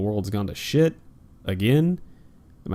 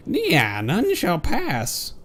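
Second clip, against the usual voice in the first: mock villain; 7 semitones higher, much slower than usual. Mocking the villain line.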